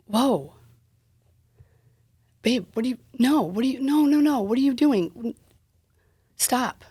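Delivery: high, rising panic; frantic plea to stop; alarmed pleading. Retold or imitated. Her frightened pleading retold.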